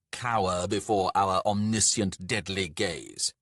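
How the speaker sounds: loud, booming command; grandiose, menacing dwarf lord; imperious god. Acted. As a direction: order a mortal to grovel. Lord commands mortal to cower.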